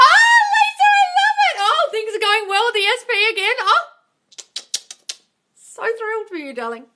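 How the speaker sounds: very high, loud, effusive squeal of joy; gushing delight. Real, not acted. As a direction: gush over her news like a thrilled friend. Gushing reply to a happy comment.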